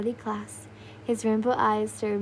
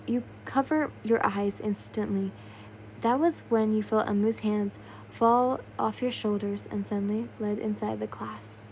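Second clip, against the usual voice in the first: ordinary delivery, nothing marked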